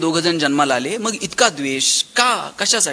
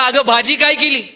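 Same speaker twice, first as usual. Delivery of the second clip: louder, higher call to the wife; आवाज देणे; 4 semitones higher, 5 dB louder than usual. Retold or imitated. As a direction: call out from across the room. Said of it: "तिथून आवाज दिला". (मोठ्याने हाक मारत)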